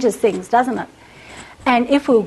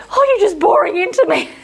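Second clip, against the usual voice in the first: husband's teasing reply; 7 semitones higher than usual. Retold or imitated. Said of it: "He says".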